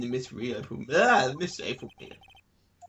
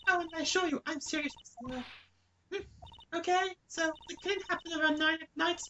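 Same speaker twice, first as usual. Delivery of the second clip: much higher, bratty witness yelling; high put-on girly witness voice; shrill April May voice; 12 semitones higher than usual. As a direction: snap at everyone like a spoiled starlet. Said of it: "doing this voice".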